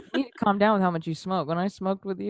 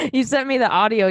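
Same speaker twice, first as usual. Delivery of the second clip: ordinary talk, nothing marked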